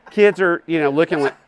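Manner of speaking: normal talking voice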